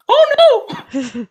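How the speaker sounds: loud, very high cartoon panic; mock alarm. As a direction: cry out in hammed-up dismay. Acting out scene from the show.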